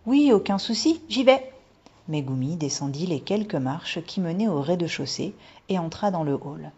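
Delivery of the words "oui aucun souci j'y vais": put-on high, loud, chirpy little girl; eager agreement. A narrator's reading. Daughter agrees to mother's errand, eager.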